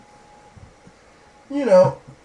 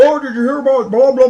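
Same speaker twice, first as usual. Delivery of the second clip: higher, louder mock eager voice; mocking Aaron; 8 semitones higher, 9 dB louder than usual. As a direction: imitate him bringing politics up again, teasing. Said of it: "Next thing you know".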